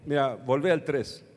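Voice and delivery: usual speaking voice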